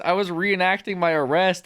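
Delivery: normal talking voice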